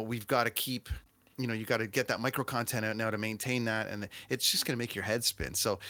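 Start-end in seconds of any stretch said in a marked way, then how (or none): none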